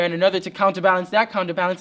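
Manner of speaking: ordinary delivery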